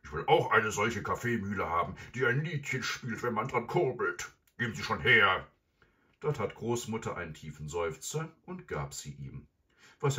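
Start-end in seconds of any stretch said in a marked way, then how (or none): 0.0-5.5 loud, raised villain voice; greedy gruff robber. Acted. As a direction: demand it loudly and impatiently. Robber figure demanding in story.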